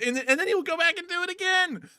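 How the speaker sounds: high-pitched exasperation; exasperated high voice. Real, not acted. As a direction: rant up high in disbelief.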